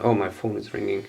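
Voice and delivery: ordinary talk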